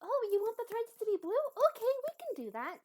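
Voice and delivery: high, quick, delighted helper; eager, delighted character. Acted. Abby learns Croak wants blue thread, delighted and eager.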